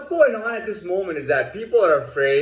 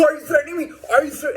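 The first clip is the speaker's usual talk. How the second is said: high, alarmed mock guard voice; shrill the accusation up high; imitating the guard crying threat; mock accusing guard; 13 semitones higher, faster than usual.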